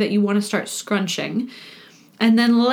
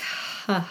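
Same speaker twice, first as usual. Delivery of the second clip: breathy sigh; 3 semitones lower, 9 dB quieter than usual. Shown as a demonstration. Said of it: "you can let go on some sound if you like".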